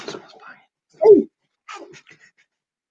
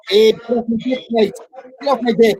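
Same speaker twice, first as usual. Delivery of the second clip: high mock Aussie accent; Australian accent; 5 semitones higher than usual. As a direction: ham up an Australian twang.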